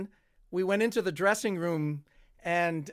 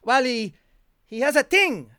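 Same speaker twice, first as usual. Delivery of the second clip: put-on French accent; 7 semitones higher, 9 dB louder than usual. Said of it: "he was a French, a francophone".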